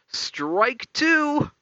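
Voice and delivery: high, drawn-out mock umpire's call; high-pitched retold shout; imitated yell. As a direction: yell it out like the buddy heckling. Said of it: "like, just yells".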